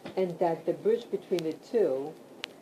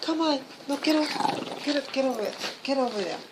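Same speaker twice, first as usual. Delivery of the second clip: much higher, firm commands to the dogs; shooing dogs; 8 semitones higher, much faster than usual. Real, not acted. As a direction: push the dogs away with sharp calls.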